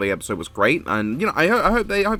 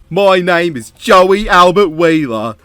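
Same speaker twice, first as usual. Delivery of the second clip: put-on loud, high Brooklyn-accented tough guy, slow start; Joey's Brooklyn accent; 4 semitones higher, 7 dB louder than usual. Joey confessing on tape in his Brooklyn accent. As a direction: lay the Brooklyn accent on thick. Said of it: "in this accent".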